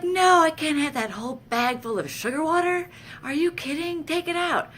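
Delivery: very high, loud frantic pleading; frantic protest. Retold or imitated. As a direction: demand they pull the IV out. Protesting sugar water IV.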